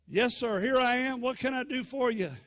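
high, loud, dutiful answer; eager reporting. Retold or imitated. Boy reports to his master a third time, loud and eager. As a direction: sing out ready for orders.

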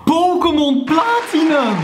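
high, slow delighted announcement; excited. Real, not acted. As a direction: call out the find with glee. Unboxes old Pokémon game.

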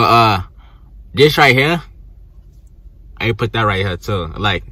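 speaking with finger in mouth corner; finger pulling lip. Real, not acted. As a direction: lip held aside while talking. Seen 1: Finger hooks lip corner, showing braces, until 1.4; hand then leaves.

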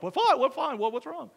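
high, startled questions; a mock-worried crowd asking; alarmed people. Congregation startled by pastor's sudden word, alarmed.

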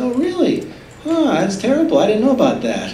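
high mock-innocent "oh really"; feigned surprise. Jesus pretending not to know of his own death, high and mock-surprised. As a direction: play dumb, wide-eyed.

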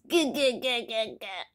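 short repeated throaty gurgles; baby gargle. A narrator's reading. Baby gargles while having pins done, gurgling. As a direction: gargle a quick string of syllables. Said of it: "he gargles".

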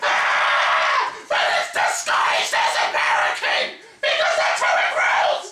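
wildly swooping mock voice, half shouted; hammed-up silly voice. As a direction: clown it up, swinging up and down in pitch. Silly explanation of happy can.

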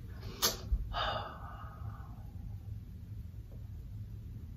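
long, soft sigh; exasperated sigh. Real, not acted. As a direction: let out a long, quiet breath. Exasperated over tragic eyebrows.

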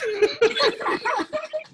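loud high laugh bursting out; high burst of laughter. Real, not acted. Laughing at own story.

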